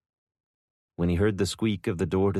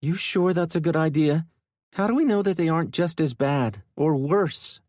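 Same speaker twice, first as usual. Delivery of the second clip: doubtful woman; 10 semitones higher, 4 dB louder than usual. Acted. Isabella doubts the casino people.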